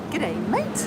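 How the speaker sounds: Australian g'day, hammed up for the viewer; broad Australian g'day. Shown as a demonstration. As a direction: swing mate way up like a cheery Aussie. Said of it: "that kinda gives it away".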